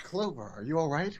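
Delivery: soft concerned voice. Acted. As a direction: ask it gently, worried for her. Reading Snake's lines.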